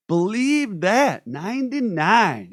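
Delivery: slow, loud, high celebration of episode ninety-nine; excited hype. Real, not acted. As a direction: sell the milestone, stretch it out. Hyping the show's episode count.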